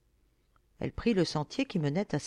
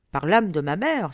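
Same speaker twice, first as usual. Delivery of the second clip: louder, mild exclamation; exclaiming; 8 dB louder than usual. A narrator's reading. Young lord struck by the girl's courage, exclaiming. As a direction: swear it out loud in admiration. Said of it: "s'écria Hamon".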